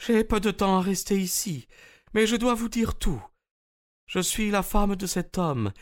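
Anna giving herself up to Holmes, dignified; Russian woman Anna. Acted. Narrator voices Russian woman.

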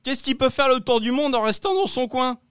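very high, thin cartoonish voice; high silly riddle voice. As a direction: squeak the riddle like a silly character. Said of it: "la voix de faux raccord".

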